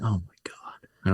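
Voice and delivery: slow, whispered inner groan; hushed, drawn-out retold thought; whispered dismay. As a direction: whisper it to yourself in disbelief.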